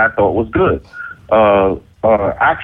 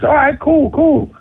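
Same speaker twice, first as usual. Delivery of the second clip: high-pitched "all right, cool"; stung acceptance; slower than usual. Retold or imitated. Rapper recalling being called washed, stung acceptance. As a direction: take the insult as a challenge.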